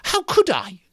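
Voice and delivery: Piggy bristling, thrown on the defensive; indignant cry. Acted. Piggy protesting to Ralph, high and indignant. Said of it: "cried Piggy indignantly".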